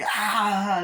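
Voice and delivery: high mock scream; imitated shriek; electrocution scream. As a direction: cry out as if zapped. Imitates doll being electrocuted.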